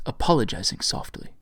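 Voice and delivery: ordinary delivery, nothing marked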